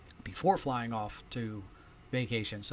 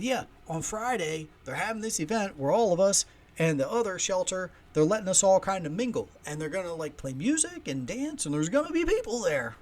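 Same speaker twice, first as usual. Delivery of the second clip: Wild Bill impression; 6 semitones higher, 5 dB louder than usual. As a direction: imitate a nervous oddball explaining a social event. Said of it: "I'm doing a Wild Bill impression".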